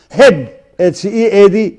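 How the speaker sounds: ordinary talk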